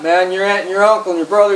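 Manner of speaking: usual speaking voice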